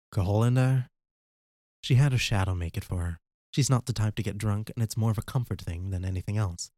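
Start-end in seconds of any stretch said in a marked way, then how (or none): 0.1-0.9 lightly voiced sly trickster; a touch of a sneering voice; a hint of a leering liar. Rukaler voiced by narrator.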